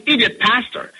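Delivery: ordinary talk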